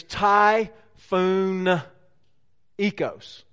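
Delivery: slow, stretched-out Southern twang; Southern-twanged Greek, syllable by syllable. Shown as a demonstration. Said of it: "say this with a southern twang".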